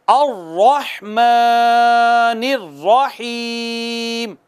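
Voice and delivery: अर्रहमानिर्रहीम drawn out in two places; slow recitation. Shown as a demonstration. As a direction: recite slowly for the class to repeat. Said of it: "दो जगहों पे हमने खींचा". (मेरे बाद दोहराने के लिए धीमी तिलावत)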